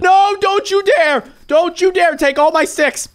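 high, loud warning yell; angry shout. Real, not acted. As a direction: warn the bully off with force. Bully threat.

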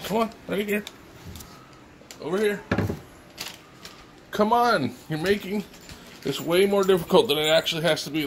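high, sing-song call to a pet; coaxing cat. Real, not acted. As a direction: coax the cat over. Calling the cat.

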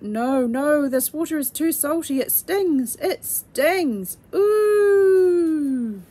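pained little voices wailing, rising to a loud ooh; crying out in pain. A narrator's reading. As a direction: cry out as the salt stings your eyes. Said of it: "kneecap eyes cried out".

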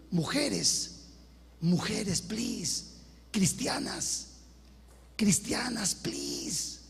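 hushed, pleading whisper to the women. Acted. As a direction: beg them under your breath.

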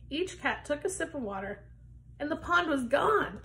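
plain reading voice

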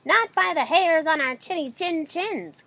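high, loud frightened little pigs; pigs crying out. A narrator's reading. Two pigs refusing the wolf, high frightened cry. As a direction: cry it out in fear. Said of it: "cried the pigs".

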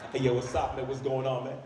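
casual fake American-accent greeting; put-on American accent. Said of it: "I am now proud of my African accent".

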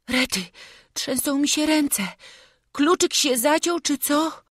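put-on high, frightened boy's voice; scared lad fumbling with shaking hands; anxious, high-pitched fretting; Jasiek panicking. A narrator's reading. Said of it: "szepnął Jasiek".

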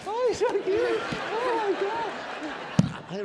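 loud falsetto coo, drawn out; mock cutesy gush; gushing new owner. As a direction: squeal adoringly, very high and overdone.

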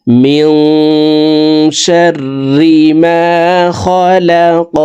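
slow melodic chant; Quranic recitation. Shown as a demonstration. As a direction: recite calmly, stretched. Recites next verse. (ধীর সুরেলা তিলাওয়াত)

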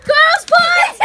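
high, loud alarm yell; panicked shout. Pretend. Panic in pretend surgery.

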